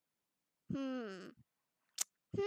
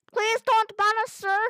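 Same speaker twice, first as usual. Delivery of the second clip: mock pleading; 7 semitones higher, 8 dB louder than usual. Pleads with game character.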